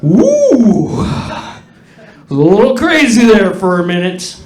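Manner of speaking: high, loud woo of relief; exhausted woo. Retold or imitated. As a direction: blow out a big whoo then laugh it off. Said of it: "she had to been like".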